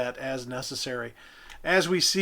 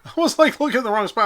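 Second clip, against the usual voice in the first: laughing; 8 semitones higher, 8 dB louder, faster than usual. Real, not acted. Laughing at own mistake.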